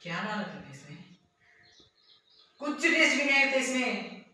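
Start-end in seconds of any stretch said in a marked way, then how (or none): 2.6-4.2 loud, high-pitched outburst; exasperated shouting; angry complaint. Acted. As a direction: berate the cook over the food. Complains about tasteless food.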